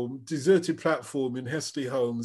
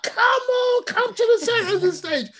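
a much higher, louder call across the stage; mock stage call; 7 dB louder than usual. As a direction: beckon him out like a frontman mid-gig. [name] calling him onstage.

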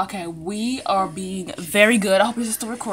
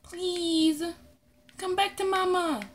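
pleading mama; 8 semitones higher, 4 dB quieter than usual. Real, not acted. Pleading pet to return.

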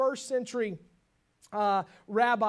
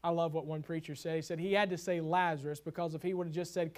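ordinary talk, nothing marked

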